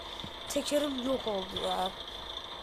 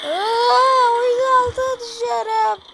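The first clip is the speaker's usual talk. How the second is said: high loud alarm; frantic high cry of falling; panicked yell; 11 semitones higher, 11 dB louder, slower than usual. Real, not acted. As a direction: shout it while falling through the map. Falling under the game map.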